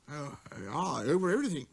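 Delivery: magnificent simplicity. A narrator's reading. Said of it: "with magnificent simplicity".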